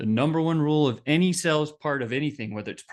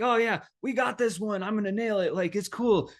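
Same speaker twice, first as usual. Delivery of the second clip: higher, breezy overconfidence; cocky reassurance; 6 semitones higher than usual. Retold or imitated. Telling his boss the deal is certain, breezy. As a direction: brush it off like it's in the bag. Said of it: "And I'd be like".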